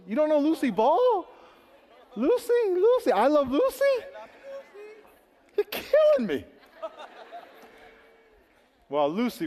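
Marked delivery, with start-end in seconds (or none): 0.1-3.8 high, loud incredulous cry; can't believe they don't know her; shrill-free mock outrage, much higher and louder; disbelief. Real, not acted. Preacher amazed youth don't know Lucy. 5.6-6.4 high, loud laugh through the words; real laughing groan; laughing exasperation. Real, not acted. Laughing at youth not knowing.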